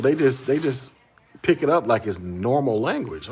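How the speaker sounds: ordinary delivery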